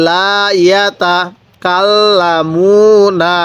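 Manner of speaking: লা ইয়াতা কাল্লামুনা drawn out very slowly, double l in কাল্লামুনা held; slow recitation. Shown as a demonstration. Teacher modelling slow Arabic recitation. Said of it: "কিভাবে পড়ব?".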